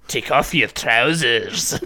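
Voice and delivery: slow, drawn-out bad Scottish accent; hammy Scottish stranger giving a creepy order; comically bad Scottish accent, stretched out; put-on Scottish accent. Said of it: "a Scottish dude".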